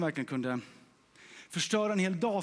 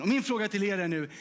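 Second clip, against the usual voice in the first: usual speaking voice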